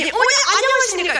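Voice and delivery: high, quick shouted hello; loud greeting. Acted. Evangelist arriving at the Buddhist temple, high loud call. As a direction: hail them loudly at a high pitch. Missionary hails startled grandmothers.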